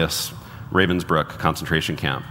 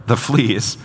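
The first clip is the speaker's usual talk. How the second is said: higher, disbelieving repeat of "the fleas"; incredulous echo; 4 semitones higher than usual. A narrator's reading. Corrie balks at her sister's thanks, incredulous. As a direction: throw "the fleas" back in disbelief.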